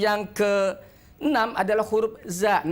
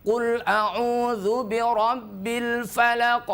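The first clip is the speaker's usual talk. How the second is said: slow chanted recitation; 3 semitones higher, slower than usual. Shown as a demonstration. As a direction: recite slowly, breath held. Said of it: "Ditahan udaranya".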